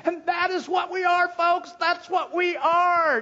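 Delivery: loud, high ringing proclamation; emphatic exclamation. Acted. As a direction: drive it home to the congregation. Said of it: "this emphatic exclamation".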